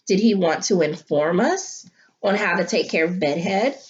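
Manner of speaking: normal talking voice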